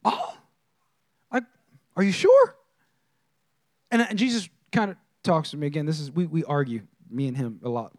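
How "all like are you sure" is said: mock disbelief. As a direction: play yourself gasping at Jesus. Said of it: "So I'm like".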